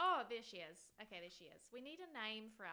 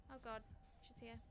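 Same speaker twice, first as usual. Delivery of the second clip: ordinary delivery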